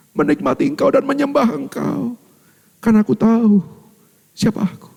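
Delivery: a sinner begging just a corner; humble repentant thief. Acted. Thief voiced, humble longing. (penjahat yang rendah hati memohon)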